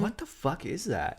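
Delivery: high-pitched mock bewilderment; retold shocked outburst. Retold friend's shocked reaction outside store.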